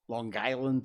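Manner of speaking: "Long Guyland" in a local accent; Long Island accent. Shown as a demonstration. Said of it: "has its own accent".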